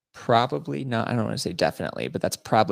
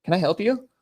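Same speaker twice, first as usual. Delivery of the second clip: high, loud, put-on polite puzzlement; feigned innocence; 12 semitones higher, 5 dB louder than usual. Passenger playing oblivious to TSA lady, feigned innocent query. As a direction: ask it as if you had no clue.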